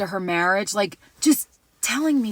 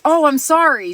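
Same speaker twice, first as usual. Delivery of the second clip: quick mock apology, higher and louder; imitating a stranger's sudden sorry; startled apology; 5 semitones higher, 5 dB louder than usual. Older shopper who almost bumped into her, startled apology. Woman apologizes for near bump.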